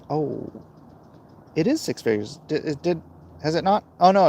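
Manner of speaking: ordinary talk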